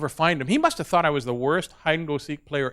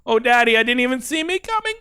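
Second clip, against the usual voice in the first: put-on high, dazed small-child voice; little boy voice; 7 semitones higher than usual. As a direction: imitate a hurt toddler, pitch climbing. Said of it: "He's three years old at the time".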